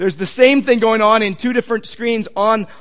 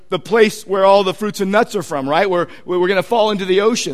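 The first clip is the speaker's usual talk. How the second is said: ordinary delivery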